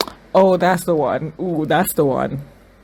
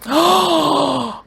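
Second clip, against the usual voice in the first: long, high, loud gasp; sharp high-pitched gasp; shocked gasp; 12 semitones higher, 6 dB louder than usual. Real, not acted. Shocked reaction to the scene.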